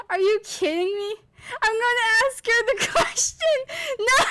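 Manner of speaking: high squeals through laughter; can't stop laughing while talking; laughing hysterically. Real, not acted. Laughing fit over absurd answer.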